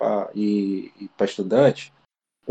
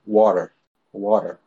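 American English; 3 semitones lower, slower than usual. Shown as a demonstration. Explaining American English to a friend, American-accented water. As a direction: say water the way Americans do, tapped middle t. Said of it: "inglês americano, por exemplo, a palavra água".

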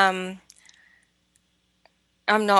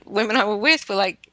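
ordinary talk, nothing marked

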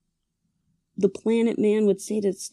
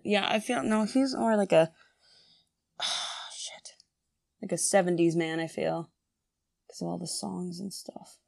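ordinary talk

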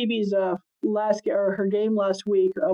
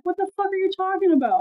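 mock incredulous; 10 semitones higher than usual.

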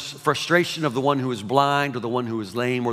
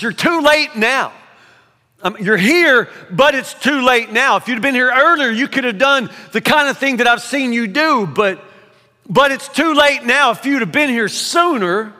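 higher and louder, bitter reproach; accusation; 8 semitones higher, 5 dB louder than usual. Acted. Sister accusing Jesus of arriving too late, loud and bitter. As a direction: throw the blame at him. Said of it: "The accusation".

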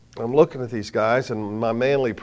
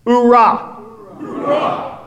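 high loud battle cry; shouted hoorah; 11 semitones higher, 7 dB louder, much slower than usual. Shown as a demonstration. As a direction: bark it out like a Marine. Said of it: "Why don't you say that for me?".

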